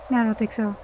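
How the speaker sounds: normal talking voice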